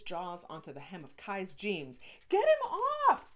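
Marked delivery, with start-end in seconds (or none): 2.3-3.4 very high, very loud panicked scream; terrified scream. Acted. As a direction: scream for help as the dog bites. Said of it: "Kai screamed".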